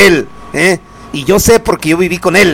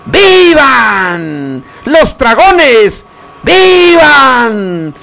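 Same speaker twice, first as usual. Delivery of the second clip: high, long drawn-out cheers; stretched festive cries, falling at the end; festive viva shouts; 6 semitones higher, much slower than usual. Acted. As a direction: call out toasts like a fiesta announcer. Radio host calling out vivas.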